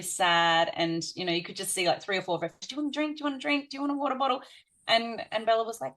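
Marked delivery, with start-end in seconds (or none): 2.6-4.4 put-on higher childlike voices, eager and fussing; light imitation of fussing children; eager little kids. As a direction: play small kids crowding round with drinks. Other children offering drinks.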